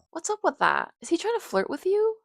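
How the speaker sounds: high, loud, incredulous interrogation; jealous questioning. Acted. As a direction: push the question up in jealous disbelief. Jealous friend questions her about Frank.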